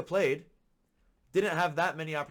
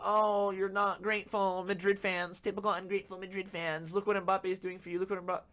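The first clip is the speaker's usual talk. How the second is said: mocking comment voice; 6 semitones higher than usual. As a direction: sneer it like a smug keyboard warrior. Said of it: "keyboard warriors keep going crazy in the comments talking about".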